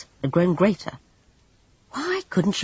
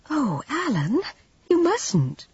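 high, pleading protest; dismayed Jane; 11 semitones higher, slower than usual. Acted. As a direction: beg him not to.

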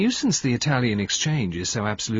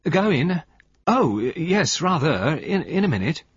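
distracted, higher and louder reply; rather absent; 8 semitones higher, 4 dB louder, slower than usual. A narrator's reading. Husband answering his wife while watching another woman, absently. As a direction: answer with your attention elsewhere, stumbling a little. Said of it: "he said rather absently".

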